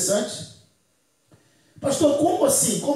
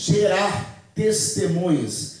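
slow emphatic repeat; 6 semitones lower, much slower than usual. Shown as a demonstration. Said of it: "Diga assim comigo".

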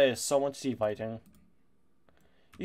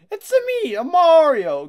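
squeaky high Italian plumber voice, cracking; a strained, voice-cracking Mario impression; bad Mario impression; 10 dB louder than usual. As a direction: belt a cartoon Mario catchphrase way up high. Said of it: "I don't have a good Mario accent".

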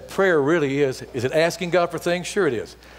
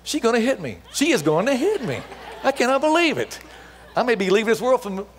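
much higher, rising mock panic; 9 semitones higher than usual. Preacher telling crowd he feared the old woman would hit him, in high mock panic. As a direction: squeal it like you're about to get hit. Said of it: "I was, like, really nervous".

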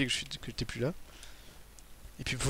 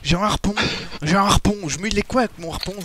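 exasperated yelling; 7 semitones higher, 7 dB louder than usual. Real, not acted. Exasperated gamer yelling mid-fight.

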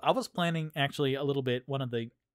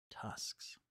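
whispering; 14 dB quieter than usual. Real, not acted. Aside to self.

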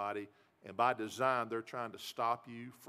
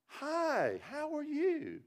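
much higher, sugary-sweet lady's voice; sweet lady greeting; much slower than usual. Retold or imitated.

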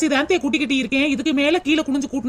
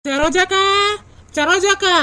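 calling out; 5 semitones higher, slower than usual. Acted. Calling neighbour at open house.

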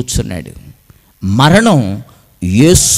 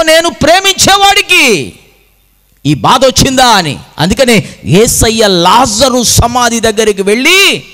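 high preaching, building to a cry; impassioned preaching; 9 semitones higher, 4 dB louder than usual. Real, not acted. Preacher tells the congregation of grief for loved ones, impassioned and high. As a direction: drive it upward with rising fervour.

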